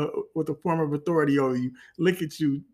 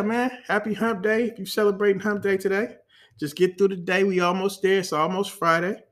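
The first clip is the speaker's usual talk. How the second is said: normal talking voice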